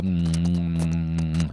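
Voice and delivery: long, low "mm" of food pleasure; satisfied hum. Shown as a demonstration. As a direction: hum low as if savoring a sweet. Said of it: "I go".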